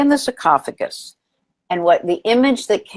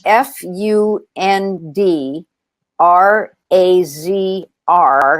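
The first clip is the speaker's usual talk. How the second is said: slow spelling; much slower than usual. Shown as a demonstration. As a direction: spell each letter out slowly and clearly. Spelling out the website name.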